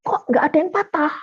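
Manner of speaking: high, puzzled exclamation; surprised doctor. Retold or imitated. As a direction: sound baffled at the x-ray. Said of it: "dia kaget". (dokter kaget dengan suara tinggi)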